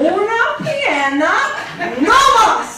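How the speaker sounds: high, loud, slow Spanish ordering; Spanish-accented order. Retold or imitated. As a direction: call the order out piece by piece. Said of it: "didn't speak much English".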